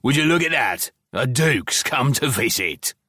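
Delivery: goblin mocking Scythe as a visiting duke, sarcastic; sarcastic sneer.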